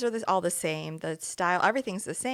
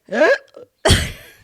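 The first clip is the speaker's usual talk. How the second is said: loud, higher mock hiccup; full-on hiccup imitation; 7 semitones higher, 13 dB louder than usual. Co-host imitating her friend's hiccup, full-on and loud. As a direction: imitate a huge full-body hiccup, loudly. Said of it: "it's like, it's a full on".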